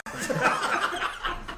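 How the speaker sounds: high burst of laughter. Real, not acted. Laughing at the sub sent off for a penalty. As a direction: squeal of laughter at the punchline.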